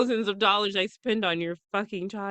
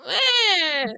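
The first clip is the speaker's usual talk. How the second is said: put-on higher, louder angry toddler grunt; 9 semitones higher, 5 dB louder than usual. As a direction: grunt like a cranky baby. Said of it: "She goes".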